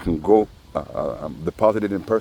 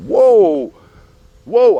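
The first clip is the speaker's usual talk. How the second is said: much higher, much louder stunned whoa; shocked whoa; 11 dB louder than usual. Pretend. Friend seeing the neighbor's face, loud shocked whoa. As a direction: recoil in loud disbelief. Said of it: "You'd be like".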